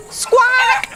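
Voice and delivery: a high, loud squawk; high chicken squawk. A narrator's reading. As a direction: squawk sharply like a hen. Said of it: "said Chicken".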